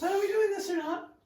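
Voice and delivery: high put-on character voice.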